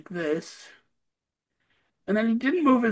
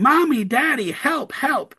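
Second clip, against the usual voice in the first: high, louder mock cry for help; imitated child's shout; 7 semitones higher, 5 dB louder than usual. Son calling his parents for help from bed, imitated shouting. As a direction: call out like a retold child's shout. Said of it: "starts shouting".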